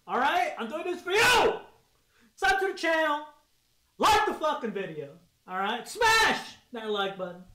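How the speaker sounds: loud, pumped-up channel plug; hype shouting. Real, not acted. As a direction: yell it to the viewers. Hyped channel plug mid-stunt.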